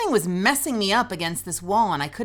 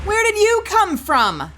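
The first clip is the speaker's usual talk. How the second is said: startled shout; 9 dB louder, slower than usual. Real, not acted. Enemy appears suddenly.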